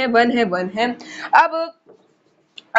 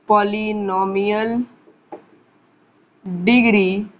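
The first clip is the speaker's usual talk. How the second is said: slow and lower, pausing after each word; slow dictation; 4 semitones lower, slower than usual. Real, not acted. Teacher giving students a definition to write, slow dictation. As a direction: say each word slowly as if writing it.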